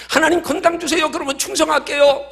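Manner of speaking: high-pitched eager bargaining; mock pleading. Believer bargaining with God for health, mock pleading.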